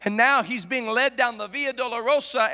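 usual speaking voice

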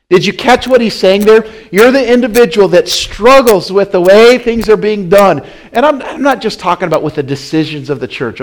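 loud, high, driving preacher's cry; preaching at full force. Acted. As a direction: preach it hard at the congregation. Preacher hammers the point.

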